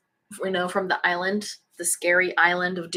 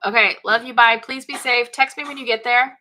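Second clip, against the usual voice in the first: fretful worried mom; 3 semitones higher, 5 dB louder than usual. Real, not acted. Mom to son leaving by bike.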